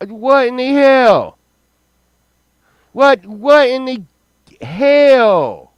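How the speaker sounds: very high, loud what in the hell; high incredulous outburst. Real, not acted. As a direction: shriek of disbelief at the find.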